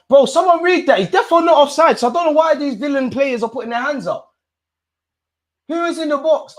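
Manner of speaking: high, fast, loud outburst; excited yelling. Real, not acted. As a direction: yell it out in disbelief. Fan yelling at offside call.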